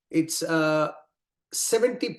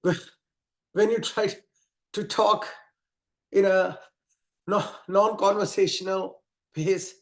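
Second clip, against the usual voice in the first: out of breath; 3 semitones higher than usual. Shown as a demonstration. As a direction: talk like you're gasping mid-run. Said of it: "this is how it'll sound".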